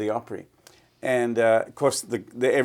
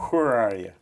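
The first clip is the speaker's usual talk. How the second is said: put-on East Tennessee accent; 3 dB louder than usual. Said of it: "strong East Tennessee accent".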